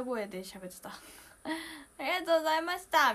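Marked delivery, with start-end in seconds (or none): 1.4-3.1 a touch of tearful strain, louder and higher; mock teary voice. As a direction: imitate thanking fans while choking up. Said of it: "全然もう涙声で". (涙声の真似で)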